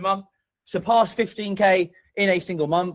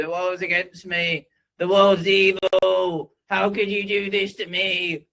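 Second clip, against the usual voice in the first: slow, higher whiny moaning; mock whining complainer; 4 semitones higher, slower than usual. Mocking the redundant guy who complains, slow whine. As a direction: drag it out like a self-pitying victim. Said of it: "The one that cries and complains and goes".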